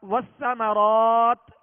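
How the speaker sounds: "والثمرات" chanted slow and loud, closed on t; slow recitation of "والثمرات" ending on an unvowelled t; full ta stop. Shown as a demonstration. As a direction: stretch the last aa of "والثمرات", then a clear t. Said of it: "وہ تا مکمل پڑھیں گے".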